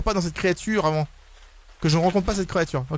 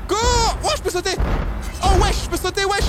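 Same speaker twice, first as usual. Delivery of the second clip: panicked shouting; 11 semitones higher than usual. Real, not acted. Gamer chased again by Siren Head, panicked high shouts. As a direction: scream for your life.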